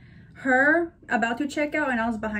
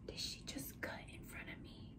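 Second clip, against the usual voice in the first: whisper; 24 dB quieter than usual. Retold or imitated. Inner thought, unvoiced.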